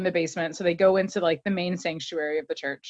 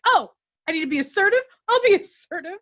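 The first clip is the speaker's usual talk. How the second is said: fast, higher mock huff, rising to a louder, much higher huff; defensive outburst; 10 semitones higher, 4 dB louder, faster than usual. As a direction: imitate him taking the dare. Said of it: "And he's like".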